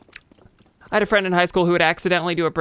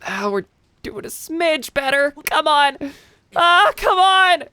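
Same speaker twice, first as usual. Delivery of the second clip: higher and louder "come on"; high strained pleading with the game; frustrated urging; 11 semitones higher, 5 dB louder than usual. Real, not acted. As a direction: push the character on, exasperated. Frustrated cheering on the climb.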